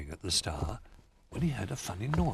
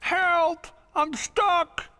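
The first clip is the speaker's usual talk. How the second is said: high, loud cry for help; 8 dB louder, much slower than usual. A narrator's reading. Cub stuck in the gutter shouts high and loud. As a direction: call out, stuck in the snow. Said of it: "he cried with a mouthful of snow".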